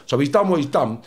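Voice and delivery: ordinary talk, nothing marked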